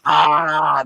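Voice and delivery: long, drawn-out mock wail; imitated cry of pain. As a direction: act out an agonized "aaah". Mimics groan of pain in bathroom.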